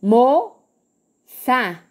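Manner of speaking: "Moça" split as mo, ça, with a clear gap; syllable by syllable. Shown as a demonstration. Teacher counting two syllables, "Moça" said in separated syllables. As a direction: break "Moça" into two beats. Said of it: "abriu e fechou a boca duas vezes".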